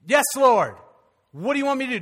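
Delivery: loud, high plea to God; eager willingness. Retold or imitated. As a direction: call out readily, offering yourself. Acts out eager prayer when saved.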